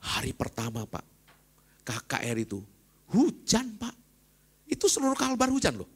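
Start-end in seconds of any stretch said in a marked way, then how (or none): 3.1-5.9 high and loud, rain everywhere; preacher stressing it rained all over Kalbar, high and loud; dramatic exclamation. Real, not acted. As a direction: raise the voice high, marvelling at the rain.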